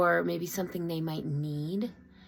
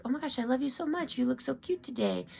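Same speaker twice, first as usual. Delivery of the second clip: high, quick, doting praise; nanny gushing over the little ones, higher; gushing to kids; 6 semitones higher than usual. Retold or imitated. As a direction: coo it like you adore the child. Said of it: "I tell the kids".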